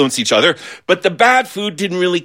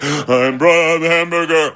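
smug hamburger. Pretend. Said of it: "the hamburger would be going".

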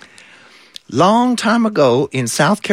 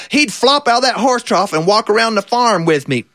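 usual speaking voice

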